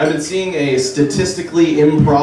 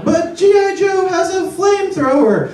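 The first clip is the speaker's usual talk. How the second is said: put-on very high child's whine; little kid. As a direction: beg like a small boy. Child voice again.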